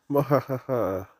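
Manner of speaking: hammed-up mwahaha cackle; evil villain laugh.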